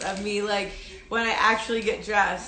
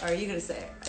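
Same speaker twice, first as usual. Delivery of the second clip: lower, softer falling answer; nervous echo; 4 semitones lower, 6 dB quieter, faster than usual. Retold or imitated. Re-enacting the other nervous lover, low soft echo. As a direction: imitate the other lover handing it back. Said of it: "both of us are like".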